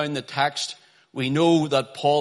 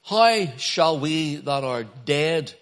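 slow emphatic reading; much slower than usual. Shown as a demonstration. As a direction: read slowly, deliberately. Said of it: "Notice here".

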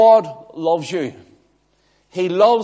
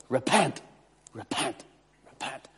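each repent softer until gone; fading softer repent; fading plea; 5 semitones lower, 10 dB quieter than usual. Acted. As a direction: let the word die away.

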